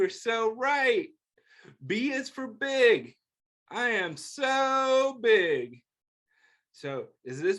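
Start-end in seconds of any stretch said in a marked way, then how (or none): 1.8-3.2 gentle picture book read-aloud; reading to children. Acted. 3.7-5.8 slow, drawn-out "so big"; stretched big. Acted. Drawn-out book line.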